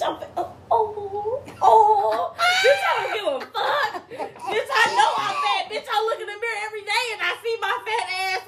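high, cracking laughter through words; laughing hard. Real, not acted. Podcaster cracking up at her own rant, high laughing shout. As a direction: laugh it out while ranting.

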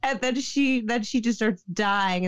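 ordinary talk

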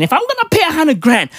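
ordinary talk

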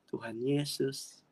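drawn-out words, rising at the end; slow tender declaration. Retold or imitated. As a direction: say it slowly, as a gentle proclamation. Retelling own gentle declaration to child. (pernyataan lembut dan perlahan)

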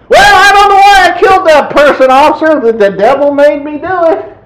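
excuse-making culprit. Acted. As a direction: plead your silly excuse to the officer. Acts excuse-making killer to officer.

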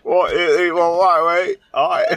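mock British accent. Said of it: "Fucking British people are fucking stupid".

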